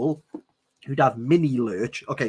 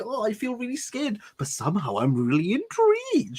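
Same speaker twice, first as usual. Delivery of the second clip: higher, lightly put-on swooning voice; a hint of a breathless heroine; lightly mocking Bella's dithering; 8 semitones higher than usual. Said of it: "She's like".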